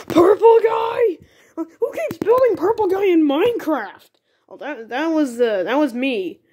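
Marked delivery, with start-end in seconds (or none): 0.1-4.1 high-pitched incredulous outcry. Acted. Gamer discovers someone's odd Minecraft build, high and incredulous. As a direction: cry out in disbelief, pitched way up.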